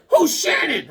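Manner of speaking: high-pitched imitation of an outraged wife; mock angry wife. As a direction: demand it like a jealous wife. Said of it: "She went nuclear".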